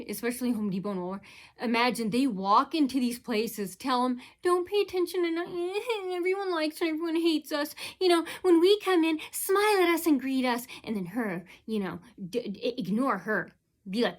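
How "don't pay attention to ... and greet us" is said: higher, sing-song mock instruction voice; a boss telling the staff how to treat her, mocked; mocking store boss. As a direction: sneer out the boss's orders in a put-on voice.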